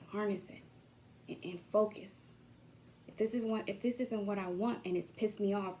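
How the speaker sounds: ordinary delivery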